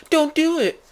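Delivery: high, loud plea to stop; mock alarmed warning. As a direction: cry out a hammed-up warning. Friend warns in improvised story.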